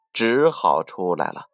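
plain reading voice

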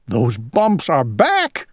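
plain reading voice

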